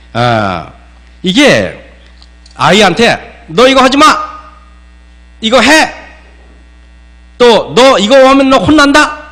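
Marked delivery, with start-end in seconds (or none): 3.5-9.2 higher, sharp mock scolding of a child. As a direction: play a parent barking orders at a kid. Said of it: "우리가 보통 이런 식으로 대화를 합니다".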